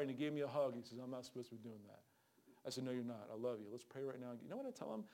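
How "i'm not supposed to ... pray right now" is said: hushed apology. Retold or imitated. Hushed exchange after hug.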